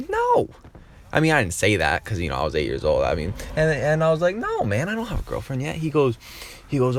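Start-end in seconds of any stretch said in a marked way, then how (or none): 0.1-0.5 very high squeaky no; mock indignant refusal. Kid answering his prying uncle, mock indignant squeal. Blurts a blunt retort in story. 4.4-5.8 lightly voiced kid, high and softening; sheepish kid. Eight-year-old answering his uncle, high kid voice.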